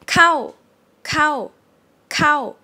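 "cow" starts high and loud, then falls; falling tone. Shown as a demonstration. Teacher demonstrates the Thai falling tone. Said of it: "carry on to a falling tone".

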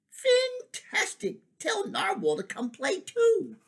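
very high, slow squeal of delight; gleeful shark. Acted. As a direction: squeal it like an overjoyed cartoon shark. Shark invites Jelly.

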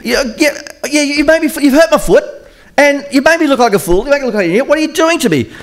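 very high, exasperated whine of complaint; indignant Balaam. Acted. Balaam scolding his talking donkey, high and indignant. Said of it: "He says".

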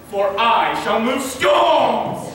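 slow booming declaration; grand boast. Acted. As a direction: boom it like a ham actor. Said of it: "This will ask for tears".

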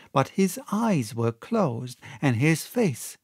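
ordinary delivery

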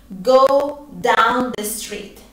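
slow, careful teaching repetition; slow model phrase. Shown as a demonstration. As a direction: Go down the street said very slowly. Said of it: "Yo voy a decir".

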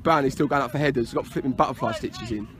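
low, soft, quick comment; quiet aside. Real, not acted. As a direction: remark under your breath to a colleague. Low aside about injured player.

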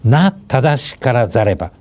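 classical line read slowly, each syllable weighed; slow recitation. Shown as a demonstration. Teacher reading a Confucian maxim slowly aloud. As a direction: intone it like a classical text. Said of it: "すなわちと読みます".

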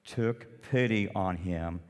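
ordinary talk, nothing marked

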